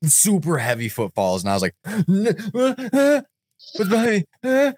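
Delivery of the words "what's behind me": gasping, high-pitched mock panic; acted-out fright. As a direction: replay being spooked while blindfolded. Retelling fright while blindfolded.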